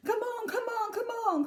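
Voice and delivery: high, urgent hurry-up call; eager cry. Acted. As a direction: hurry the group along. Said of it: "cried Mister Wonka".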